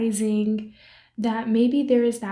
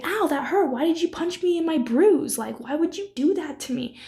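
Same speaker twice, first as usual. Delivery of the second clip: higher, hurt protest at being punched in a bruise; pained complaint; 6 semitones higher than usual. Retold or imitated. Person punched in a sore bruise, yelping a hurt protest. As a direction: act out the sting of being hit where it hurts. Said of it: "You can be".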